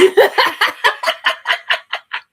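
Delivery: loud high cackle, breathy; loud taunting laugh at her enemies. Real, not acted. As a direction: burst into a long loud laugh.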